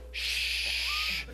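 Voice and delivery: long hissed shush; acted shushing. Someone at the door warns they can hear downstairs, acted shushing. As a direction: imitate someone hushing the speaker. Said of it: "a knock on the door that says".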